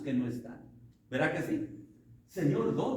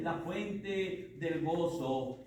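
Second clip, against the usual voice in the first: slow emphasis; 3 dB quieter, much slower than usual. Real, not acted. Emphatic sermon line.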